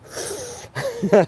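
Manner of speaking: squealing giggle; high squealing laugh. Real, not acted. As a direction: burst of high-pitched laughter. Laughing mid quote.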